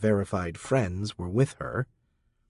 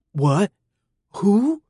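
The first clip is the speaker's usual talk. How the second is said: startled gasp; 10 semitones higher, 5 dB louder than usual. A narrator's reading. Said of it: "Potential target gasped".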